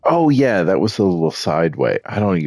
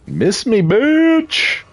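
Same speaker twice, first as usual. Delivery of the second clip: taunting shout; 4 dB louder, much slower than usual. Real, not acted. Taunting the boss in combat.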